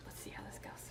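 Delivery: breathy hush; whispering. Real, not acted. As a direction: whisper it under your breath. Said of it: "Why are you whispering?".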